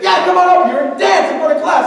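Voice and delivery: high, loud taunting order; bossy teacher. Retold or imitated. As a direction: goad the kid in front of everyone. Teacher ordering student to dance.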